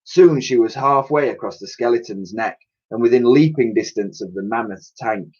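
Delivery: usual speaking voice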